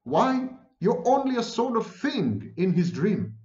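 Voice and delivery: emphatic, high-pitched put-down; storyteller's scornful comeback; contemptuous retort. A narrator's reading. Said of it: "Tweedledee retorted contemptuously".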